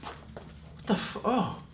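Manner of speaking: silent gasp, then loud high cheer; stunned director. Retold or imitated. Director stunned by a take, then cheering loud and high. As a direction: go from shock to delight. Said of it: "the director goes".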